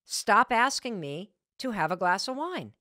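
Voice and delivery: husband telling his wife to stop pushing wine, firmly annoyed; frustrated husband. Retold or imitated. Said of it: "He looks at me very frustrated".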